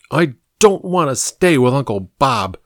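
put-on a sulky kid pleading not to go; boy's voice. A narrator's reading. Young Shane to his mother, lightly voiced sulky boy.

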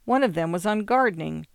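ordinary delivery, nothing marked

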